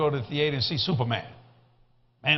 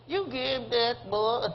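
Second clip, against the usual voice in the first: high, slow, hushed mock outrage; mock indignant disbelief; 5 semitones higher, 4 dB quieter, slower than usual. Wife aghast at the ten dollar tip, mock indignant. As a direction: imitate a scandalized wife, drawn out.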